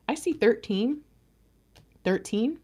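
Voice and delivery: ordinary talk, nothing marked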